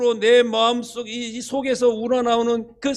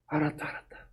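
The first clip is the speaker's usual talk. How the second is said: whispered giving in; 5 semitones lower, 13 dB quieter than usual. Acted. God giving in.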